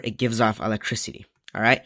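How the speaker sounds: normal talking voice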